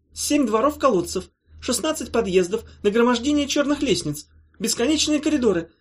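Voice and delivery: high, eager squeaky rambling; nervous creature. Acted. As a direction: babble on nervously to convince them.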